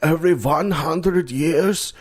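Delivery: put-on high, slow German accent; drawn-out mock-German exposition; Scary German Guy. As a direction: intone it like an old German prophet. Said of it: "they meet this old scary German guy".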